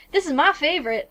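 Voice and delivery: southern shopkeeper showing a favorite; southern accent. Acted. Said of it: "doing a southern accent for these characters".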